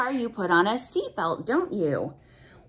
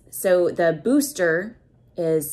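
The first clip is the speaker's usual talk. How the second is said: ordinary delivery, nothing marked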